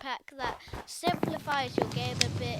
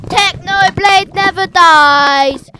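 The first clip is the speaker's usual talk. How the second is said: loud, slow, higher chant; catchphrase shout; 6 semitones higher, 21 dB louder, slower than usual. Real, not acted. Fan chanting the Technoblade motto, shouting. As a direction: belt it out like a rally cry.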